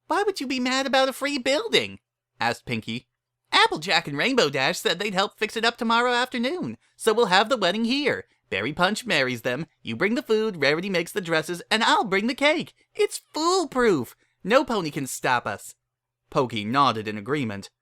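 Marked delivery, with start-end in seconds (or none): 0.1-2.0 much higher, bouncy and cheerful; giddy high pony voice; bubbly Pinky voice. A narrator's reading. Pinky's voice in the story. 3.5-15.7 much higher, bouncy and cheerful; giddy high pony voice; bubbly Pinky voice. A narrator's reading. Pinky lays out her plan.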